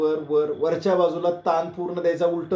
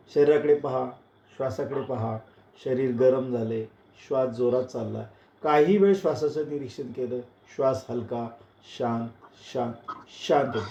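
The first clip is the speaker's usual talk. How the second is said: guided relaxation; 4 semitones lower than usual. Acted. Yoga teacher has students watch their breath settle, calm and hushed. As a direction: settle them gently, voice dropping towards stillness.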